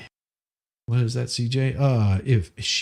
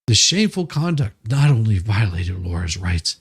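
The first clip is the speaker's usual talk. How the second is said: reading legal text aloud in a raised, pompous tone; mock formal brief reading; 4 semitones higher than usual. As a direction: declaim it like a self-important lawyer.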